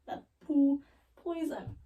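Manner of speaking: "poo poison" drawn out slowly in separate words; slow deliberate repeat. Real, not acted. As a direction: pause round "poo" before "poison". Repeats punchline for effect.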